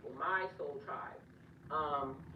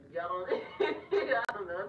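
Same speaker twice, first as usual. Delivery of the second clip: slow, louder, broken up by a laugh; 5 dB louder, slower than usual. Real, not acted. Admitting she talks back to YouTubers, laughing. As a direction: laugh midway through the thought.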